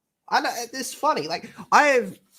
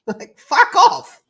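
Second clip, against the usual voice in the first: laughing put-on voice; 13 semitones higher, 10 dB louder, much slower than usual. Shown as a demonstration.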